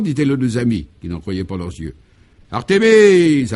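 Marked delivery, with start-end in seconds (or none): 2.5-3.5 long, drawn-out loud call across the house, much higher. Acted. Lady of the house summons the maid Artémise, long loud call. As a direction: stretch the name out as you summon her. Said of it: "appela-t-elle".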